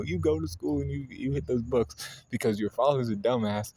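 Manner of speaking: a hint of nagging mom pushing the books; mock stern mom; Chi-Chi lecturing.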